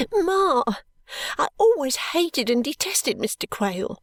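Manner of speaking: high, sobbing outburst. A narrator's reading. Daughter sobbing her dislike of the suitor to her mother, high. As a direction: sob out the hatred. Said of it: "sobbed Caddy".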